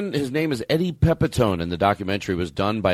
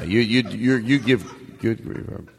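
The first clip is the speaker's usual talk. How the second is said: ordinary talk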